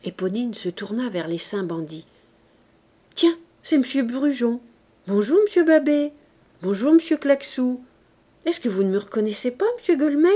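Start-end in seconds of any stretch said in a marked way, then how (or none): 3.2-10.3 higher, louder, chirpy hellos; playful social greetings. A narrator's reading. Éponine greeting the bandits one by one, chirpy. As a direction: greet each one with lively mock politeness.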